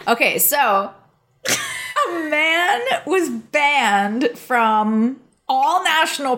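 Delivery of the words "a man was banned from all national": laughing through the words. Real, not acted. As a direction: giggle while saying it. Laughing while telling story.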